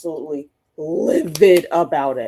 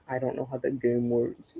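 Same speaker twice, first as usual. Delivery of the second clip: lightly mocking, a bit lower and softer; mock know-it-all; 4 semitones lower, 6 dB quieter than usual. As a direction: sneer it like a know-it-all bot. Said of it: "explain stuff like".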